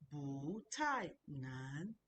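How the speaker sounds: "bu tai nan" very slow: "bu" rising, "tai" falling, "nan" rising; tone-by-tone demonstration. Shown as a demonstration. Teacher giving the response, slow tone demonstration. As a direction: draw out each tone of "bu tai nan". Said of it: "second tone".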